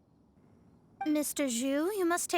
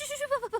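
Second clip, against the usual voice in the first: higher, awkward little laugh; embarrassed giggle to hide a blunder; nervous laugh; 8 semitones higher than usual. Acted. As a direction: laugh it off to cover the slip. Covering an embarrassing slip.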